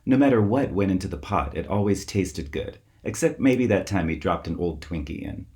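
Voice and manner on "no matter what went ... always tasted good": ordinary delivery, nothing marked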